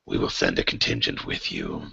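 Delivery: very low, soft monster voice; captors' creature voice. Acted. DM as captors answers the players, very low creature voice. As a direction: intone it deep and quiet. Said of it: "And they say".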